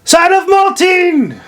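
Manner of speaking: sudden high ringing shout of the saying. A narrator's reading. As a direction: fling it out like a ritual cry. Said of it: "you should always shout".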